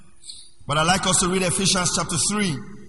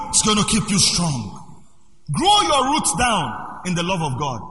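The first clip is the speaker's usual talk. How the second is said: ordinary talk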